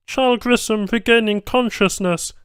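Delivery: high, flat alien system voice; machine announcer. A narrator's reading. Lab machine announcing the patient wakes, robotic. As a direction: read it like an automated alert.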